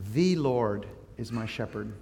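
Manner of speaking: "The" said as long "thee", drawn out; stress on THE. Shown as a demonstration. Pastor reading the psalm to his congregation, stressing "The". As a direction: slowly read it, leaning on "The". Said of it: "depending upon how you stress a word".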